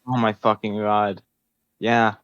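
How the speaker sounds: ordinary talk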